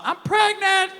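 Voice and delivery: high put-on female voice; pregnant caller. Woman phoning the man out of nowhere, high mock-female announcement. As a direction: jump way up into a woman's phone voice. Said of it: "I get that phone call".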